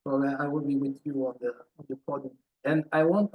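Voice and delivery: normal talking voice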